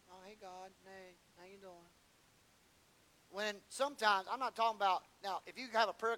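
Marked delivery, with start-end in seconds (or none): 0.1-1.9 casual mumble. Retold or imitated. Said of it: "we just kind of, you know".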